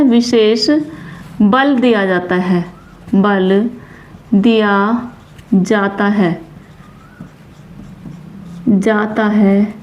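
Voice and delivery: slow, drawn-out words with long gaps; measured writing-along delivery; dictation while writing. Real, not acted. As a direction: dictate word by word as you write.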